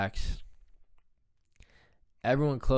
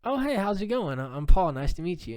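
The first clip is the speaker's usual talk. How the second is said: bright, higher friendly hello; friendly greeting; 7 semitones higher, 4 dB louder than usual. Retold or imitated. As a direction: greet the stranger cheerfully, pitched up. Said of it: "so I'm like".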